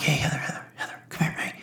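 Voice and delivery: hushed call for Heather, barely voiced; furtive whisper. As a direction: whisper someone over without being seen. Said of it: "I didn't want anybody to see me".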